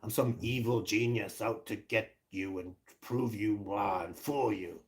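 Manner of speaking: slow, soft sinister evil-genius voice; mock evil genius. Scene: mocking how people picture him as a scheming villain, slow sinister voice. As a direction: purr it like a cartoon villain plotting. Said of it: "some kind of evil genius".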